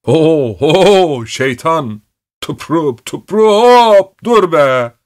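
high, loud driving calls; urging the ox. A narrator's reading. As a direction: coax and shout at a stubborn ox. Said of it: "öküze dil döküyordu".

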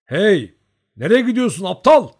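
high, loud scolding yell; shouting. A narrator's reading. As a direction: shout after someone to stop. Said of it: "diye bağırdı".

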